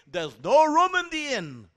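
high, loud, slow innkeeper refusal; sing-song it like a nativity play innkeeper; mock innkeeper. Teacher mimics the innkeeper turning students away, high and loud. Mock quote, no room inn.

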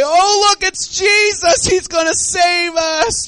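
high, loud, drawn-out cheer; mock joyful cry. Preacher imagining disciples crying out to Jesus, mock jubilant. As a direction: cry out in hammed-up relief. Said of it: "did they cry out and say".

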